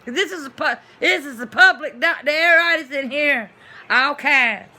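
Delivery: loud, high ranting; indignant rant. Real, not acted. Complaining about the crowded clinic, loud and high. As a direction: vent about the crowded public doctor.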